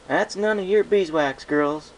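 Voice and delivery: loud, slow firm big-sister reproach; scolding. Acted. Applejack scolds the fillies for prying, loud and firm. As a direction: shut it down firmly.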